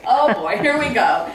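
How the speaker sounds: knowing her father is about to give a speech, amused; laughing groan. Retold or imitated. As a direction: laugh at what's coming. Dad launches into speech.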